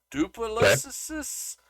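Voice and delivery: playful slow "Duplessis" with a rising end; deliberate mispronunciation. Shown as a demonstration. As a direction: sound out "Duplessis" slowly, unsure of it. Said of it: "I'm butchering these somewhat on purpose".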